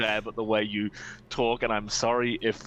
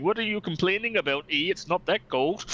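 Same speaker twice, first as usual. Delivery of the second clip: put-on high Canadian accent, eh; 9 semitones higher than usual. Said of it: "heard you, A, do an accent, B, do a Canadian accent".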